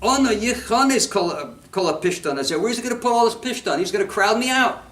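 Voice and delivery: high, agitated mock complaint; nervous coal seller. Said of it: "he's all nervous".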